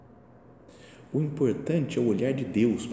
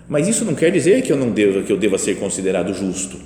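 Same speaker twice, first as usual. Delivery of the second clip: louder, fast and emphatic; forceful reading; 2 semitones lower, 7 dB louder than usual. Retold or imitated. Preacher reads Paul's letter to listeners, forcefully. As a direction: press the point hard.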